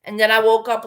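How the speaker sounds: normal talking voice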